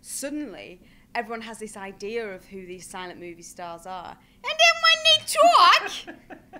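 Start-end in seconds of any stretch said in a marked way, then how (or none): 4.4-6.6 much higher, loud, slow squeak with a laugh; squeaky starlet voice. Shown as a demonstration. Actress demonstrates her character's squeaky speaking voice. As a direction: show how the silent star sounds when she talks. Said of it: "Stay out of character".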